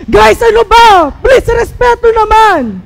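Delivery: high, loud, slow exasperated plea; exasperated class president. Retold or imitated. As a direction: lose patience with the noisy class. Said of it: "Tapos yung". (naiinis na presidente, malakas at mabagal)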